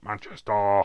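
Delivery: loud, hammed-up Manchester accent; exaggerated Manchester. Shown as a demonstration. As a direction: blare out Manchester in a put-on local twang. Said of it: "I have to say it like that".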